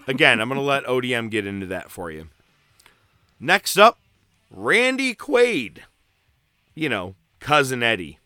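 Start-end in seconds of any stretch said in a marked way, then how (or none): 3.4-5.8 hyped announcement. Acted. Announcing cast.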